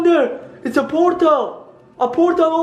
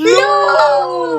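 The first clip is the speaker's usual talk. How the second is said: scared scream; 10 semitones higher, 4 dB louder than usual. Real, not acted. Hatched creature starts talking.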